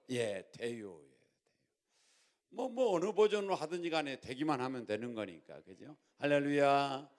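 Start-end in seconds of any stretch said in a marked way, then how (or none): 0.1-1.0 Chungcheong accent. Shown as a demonstration. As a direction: give the Chungcheong-do version. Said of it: "충청도 버전으로".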